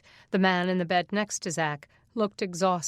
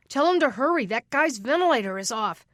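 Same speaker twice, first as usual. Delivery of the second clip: higher, louder hurried order; urgent call; 5 semitones higher, 5 dB louder than usual. Acted. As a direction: push them to hurry. Man urging help in emergency.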